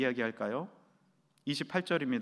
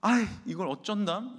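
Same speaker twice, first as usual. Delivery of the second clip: a lightly flustered "아이, 이걸 어쩐담?"; flustered sigh; 6 semitones higher, 3 dB louder than usual. Retold or imitated. Wife stuck with a dead car, mildly flustered. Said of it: "당황한 아내는".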